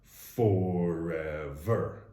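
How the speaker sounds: drawn-out, very slow "Forever"; slow, echoing Sandlot-style "forever, forever". Retold or imitated. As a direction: stretch "forever" out like the movie line. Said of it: "all I could hear was The Sandlot".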